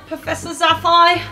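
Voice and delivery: ordinary talk, nothing marked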